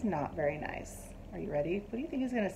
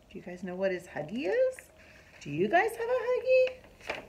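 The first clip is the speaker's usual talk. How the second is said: usual speaking voice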